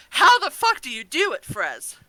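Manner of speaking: ordinary delivery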